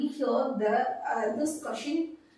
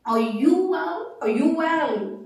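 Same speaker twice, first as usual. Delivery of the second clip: "Are you well" loud, high and slow, with big swings of tune; exaggerated, projected demo of the tune in "Are you well"; emphatic question intonation; 4 semitones higher, 6 dB louder, slower than usual. Shown as a demonstration. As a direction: sing out "Are you well" for learners, stressing "you", rising first, then falling.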